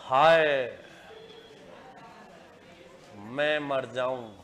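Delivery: slow, low, swooning example sentence; demonstrated exclamation. Shown as a demonstration. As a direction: sigh it low, with a long break after हाय. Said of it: "जैसे".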